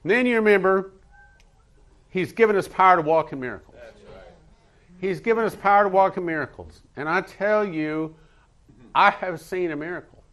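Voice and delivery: high, loud, emphatic testimony; impassioned preaching. Real, not acted. Preacher telling church of a miracle seen, impassioned preaching. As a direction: testify forcefully, pitched high.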